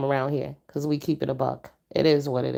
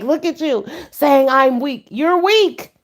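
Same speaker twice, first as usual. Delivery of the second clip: high, mocking imitation of a friend; 9 semitones higher, 5 dB louder, slower than usual. Friend retorting that she's the weak one, high mock voice. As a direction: throw the insult back in a squeaky, teasing voice. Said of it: "He was like".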